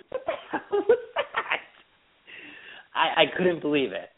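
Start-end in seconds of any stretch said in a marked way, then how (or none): 0.1-1.6 breathy, laughing and high; laughing at the rude egg scooper; laughing disbelief. Real, not acted. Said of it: "laughing disbelief".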